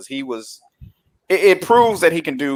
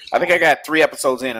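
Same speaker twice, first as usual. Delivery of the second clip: ordinary talk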